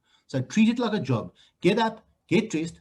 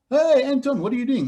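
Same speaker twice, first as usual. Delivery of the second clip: high, louder friendly hey; cheery greeting; 8 semitones higher, 5 dB louder than usual. Retold or imitated. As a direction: call out like a friend spotting you. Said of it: "people say to me".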